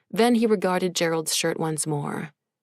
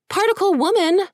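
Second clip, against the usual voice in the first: high, louder insistent correction; 5 dB louder than usual. Acted. Woman rounding up children firmly correcting the demon, raised and insistent. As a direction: correct him firmly, no argument. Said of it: "Jeannie insisted".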